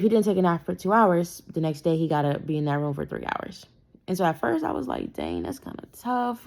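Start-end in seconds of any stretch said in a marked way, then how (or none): 5.1-6.5 slow, higher, mildly pained reaction; retold sympathy. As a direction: draw it out in mild sympathy. Sympathetic reaction to son's punishment.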